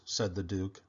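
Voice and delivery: ordinary delivery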